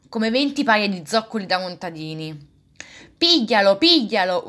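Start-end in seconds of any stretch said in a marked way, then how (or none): 3.2-4.4 louder yell to catch him; shouting. A narrator's reading. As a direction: call out to the crowd to grab him. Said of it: "urlava Geppetto". (grido forte per farlo acchiappare)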